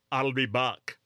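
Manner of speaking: slow, mock Austrian-accented Terminator line; a hammed-up bad Austrian Arnold accent, drawn out; imitating Arnold's Austrian accent, lightly done. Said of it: "that was a bad Arnold".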